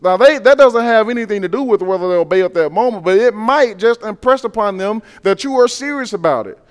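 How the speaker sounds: ordinary delivery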